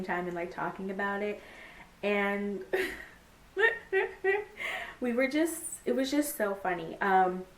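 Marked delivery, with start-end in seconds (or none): 3.5-4.9 high-pitched helpless giggle; real squeaky laughing fit. Real, not acted. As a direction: crack up in a burst of high giggles. Cracking up over friend's funny review.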